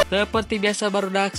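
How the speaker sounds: ordinary talk, nothing marked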